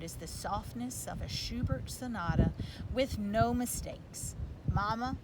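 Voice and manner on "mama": ordinary delivery, nothing marked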